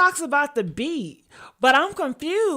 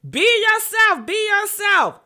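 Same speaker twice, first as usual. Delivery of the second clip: loud shout; 9 semitones higher, 5 dB louder than usual. Acted. Mock advice, shouting.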